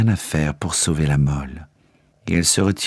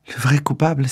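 sharply higher, stunned outcry; startled cry; 7 semitones higher than usual. A narrator's reading. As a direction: cry it out in shock. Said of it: "s'écria Marguerite". (cri de stupeur, voix bien plus aiguë)

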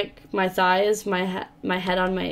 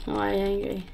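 softer, put-on voice for the angry face; character voice; 5 dB quieter than usual. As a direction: repeat it quietly in a different voice. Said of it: "second take, voiced as the character".

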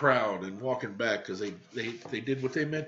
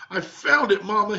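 louder and higher, triumphant call; yelling; 8 semitones higher, 8 dB louder than usual. Acted. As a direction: shout up the good news. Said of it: "he yelled".

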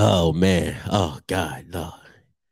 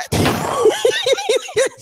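shouted high laugh. Real, not acted. Laughing at photo.

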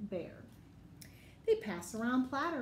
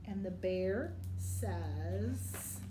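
drawn-out slow lead-in, rising to invite the answer; slow prompting; 5 semitones lower, much slower than usual. Shown as a demonstration. Teacher slows down to prompt children to answer. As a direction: stretch it out so the kids jump in. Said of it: "your turn".

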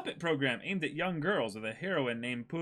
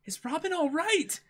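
much higher anxious question; worried crewmate. Acted. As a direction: ask in a high, worried voice. S1 reads a character's worried line.

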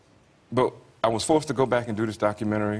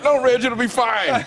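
much higher, louder and slow, a cartoonish reassurance; mock producers; 6 dB louder, slower than usual. Producers brushing off Reg's worry, high hammed-up reassurance. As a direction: ham up a breezy producer brushing off his worry. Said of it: "and they were like".

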